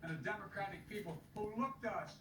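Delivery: stirring speech building to a shout; rally the crowd, end on a rousing call. Acted. Rally speech continues, building to close.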